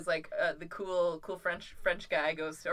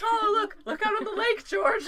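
much higher, coaxing voice to the duck; hammed-up tender farmer; 14 semitones higher, 5 dB louder than usual. Retelling the Netflix farmer soothing his duck before the kill. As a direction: gently coax the duck to look away. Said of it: "He's like".

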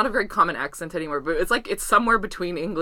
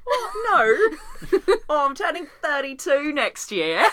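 high, hammed-up Australian accent; mock-dismayed Australian, laughing through it; 8 semitones higher, slower than usual. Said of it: "That's the most Australian age".

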